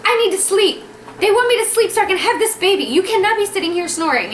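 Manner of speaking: high-pitched, exasperated scolding; retold yelling. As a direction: act out snapping at a snoring husband. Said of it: "and I yell at him".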